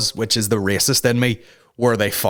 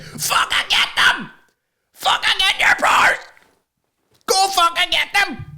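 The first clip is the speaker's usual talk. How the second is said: put-on kid's defiant screech. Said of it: "the wee guy's like".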